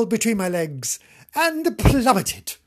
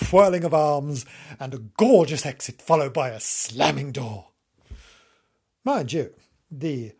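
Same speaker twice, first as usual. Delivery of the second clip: usual speaking voice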